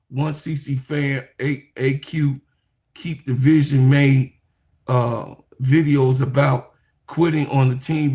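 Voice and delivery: usual speaking voice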